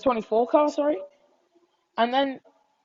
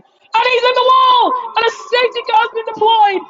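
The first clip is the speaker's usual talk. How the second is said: excited shouted crash call, higher and louder; motorsport commentator; 5 semitones higher, 4 dB louder than usual. Real, not acted. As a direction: call the crash at full volume, live.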